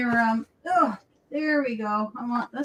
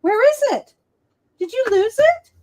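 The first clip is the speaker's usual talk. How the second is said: cooing pet talk; 9 semitones higher than usual. Real, not acted. Owner asking her dog about the lost treat, high cooing baby talk. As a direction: coo at her like a worried mom.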